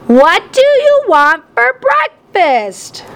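high, drawn-out yell over the din. A narrator's reading. Mom repeats her question to Dad, yelling slowly. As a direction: stretch each word to cut through the noise. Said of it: "Mom yelled".

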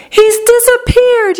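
high, sustained wail of alarm; yelling. A narrator's reading. Mother sees her son vanish, yelling. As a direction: wail it in panic, pitch soaring. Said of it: "yelled Missus Gloop".

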